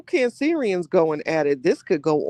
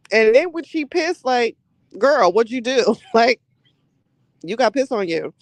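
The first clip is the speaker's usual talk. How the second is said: excited teasing; 7 semitones higher, 4 dB louder than usual. Real, not acted. Teasing retelling, laughing.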